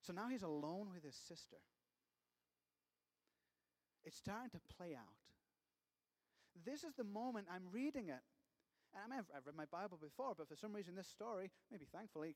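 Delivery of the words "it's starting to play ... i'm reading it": ordinary delivery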